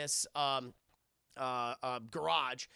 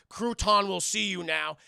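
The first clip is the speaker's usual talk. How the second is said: slow, loud bodyguard voice; mock henchman announcement; 8 dB louder, slower than usual. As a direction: announce the boss like a big goon. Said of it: "they're like".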